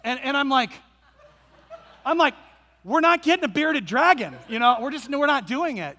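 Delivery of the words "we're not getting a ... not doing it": firm refusal. Retold or imitated. Dad refusing daughter firmly.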